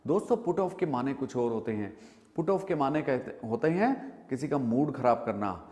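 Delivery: ordinary talk, nothing marked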